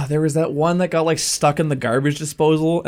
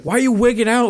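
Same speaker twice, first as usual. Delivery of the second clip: mock worried dad; 9 semitones higher than usual. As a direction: ham up a worried father. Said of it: "he's like".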